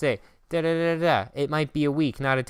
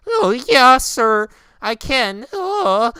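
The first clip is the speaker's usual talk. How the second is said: mock eager self; 7 semitones higher, 10 dB louder, much slower than usual. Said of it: "I was like".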